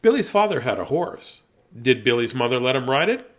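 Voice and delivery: storybook voice; story narration. Acted. Reads the story aloud.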